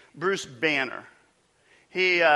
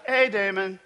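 higher, faster teasing caller voice inviting him for tea; hammed-up tender phone call; mock emotional guy; 5 semitones higher than usual. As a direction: play a man gushing about feelings, tongue in cheek. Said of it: "never had a guy call me on the phone and go".

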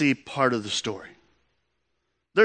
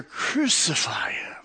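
a slow, hoarse-free whisper instead of a shout; whispered crowd cry; 2 semitones lower, 4 dB quieter, slower than usual. Retold or imitated. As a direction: breathe it out as a chilling whisper. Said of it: "they shouted all the louder".

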